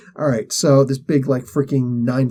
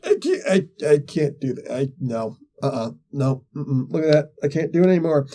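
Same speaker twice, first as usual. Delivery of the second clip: arm gone numb. Retold or imitated. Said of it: "It's like".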